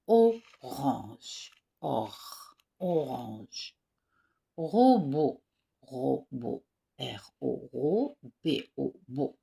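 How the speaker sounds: loud, slow teacher drill, each word held; repeat-after-me drill. Shown as a demonstration. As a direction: model every word for the class to repeat. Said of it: "On répète ensemble". (voix de maîtresse qui fait répéter)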